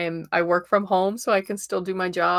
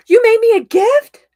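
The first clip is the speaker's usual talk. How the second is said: surprised cry; 13 dB louder than usual. Real, not acted. Surprised by a gift.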